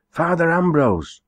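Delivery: high, breathless crying out; panting messenger. A narrator's reading. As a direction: call out running up, out of breath. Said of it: "cried the panting messenger".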